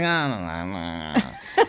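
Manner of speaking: drawn-out, reluctant mock whine. Actor replaying their reluctance to a coach, whiny. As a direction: drag it out like you really don't want to.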